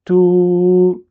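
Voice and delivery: "tout" with the oo held long and level; long sustained oo on "tout"; prolonged vowel. Shown as a demonstration. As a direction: stretch "tout" out into "touuu". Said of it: "ça prolonge".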